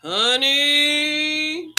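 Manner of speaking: normal talking voice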